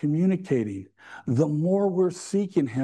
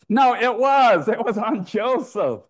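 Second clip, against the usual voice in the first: higher, emphatic joking reversal; playful insistence; 6 semitones higher, 5 dB louder than usual. Real, not acted. Preacher admits the punchline after fooling the congregation, emphatic glee. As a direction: insist loudly after teasing the audience.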